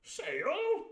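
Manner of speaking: put-on the squire echoing the boy in a high bluster; jolly squire. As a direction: squeak it like a puzzled old squire. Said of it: "says he".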